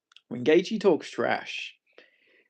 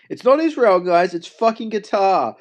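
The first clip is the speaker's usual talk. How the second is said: mock outrage; 4 semitones higher, 6 dB louder than usual. Mock conspiracy rant.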